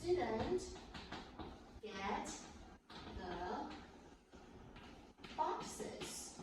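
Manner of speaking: word by word with long pauses; teacher dictating a sentence to the class; dictation pace. Shown as a demonstration. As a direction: spell it out slowly as you write. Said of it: "dictating word by word".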